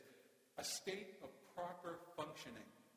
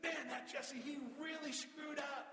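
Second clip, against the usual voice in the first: hammed-up grumpy deity, shouting; mock angry God; 6 semitones higher than usual. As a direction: play a petty, offended God, hammed up. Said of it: "he looks at you and says".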